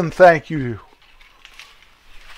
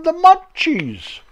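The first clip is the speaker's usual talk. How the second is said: mock Munchies voice; 8 semitones higher, slower than usual. Names the Munchies, voice shifts.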